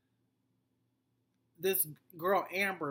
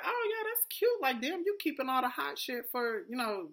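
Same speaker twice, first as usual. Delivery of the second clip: much higher, whiny mock voice, almost crying; mocking Tierra; 8 semitones higher than usual. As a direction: mimic her in a high whiny mocking voice. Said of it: "And Tierra's like".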